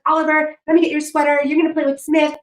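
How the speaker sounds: put-on high, sing-song call to the kids; sweet mom voice. Other mom at the party calling her son to play. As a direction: sweetly call the children over. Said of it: "she's like".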